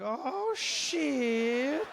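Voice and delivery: high, long-drawn realization; snake's dawning dread. Retold or imitated. Abandoned snake watching its owner leave, comic dismay. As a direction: stretch it out as the horror sinks in.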